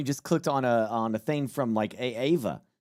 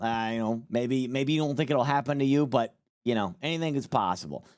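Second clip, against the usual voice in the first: ordinary talk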